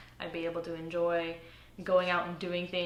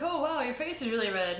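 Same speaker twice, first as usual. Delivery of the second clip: higher-pitched onlooker's exclamation; mock surprise; 6 semitones higher than usual. Everyone points out her red face, mock surprise. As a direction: imitate someone blurting out what they notice.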